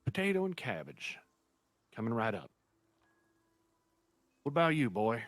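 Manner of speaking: put-on old innkeeper welcoming a returning guest. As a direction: greet an old friend cheerfully.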